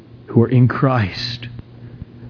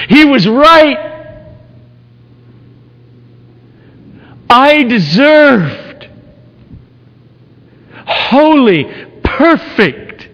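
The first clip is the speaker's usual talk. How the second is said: very high, loud cry; impassioned preaching; 12 semitones higher, 5 dB louder than usual. Acted. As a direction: cry it out in anguish. Climax confession, cried out.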